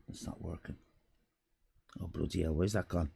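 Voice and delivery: very soft whispered grumble; muttered aside. Real, not acted. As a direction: whisper your frustration under your breath. Muttering over broken tool.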